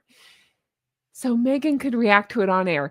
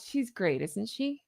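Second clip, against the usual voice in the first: calm, understated; 10 dB quieter, slower than usual. Shown as a demonstration. A host showing the understated version, quietly calm. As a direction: let the compliment land softly. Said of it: "more personable".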